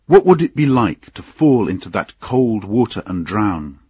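ordinary delivery, nothing marked

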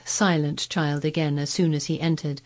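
usual speaking voice

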